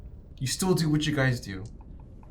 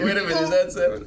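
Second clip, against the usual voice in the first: quick, loud puzzled self-quote; loud, fast mock double-take; mock confusion; 5 semitones higher, 7 dB louder, faster than usual. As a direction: replay the confused moment. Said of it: "I was like".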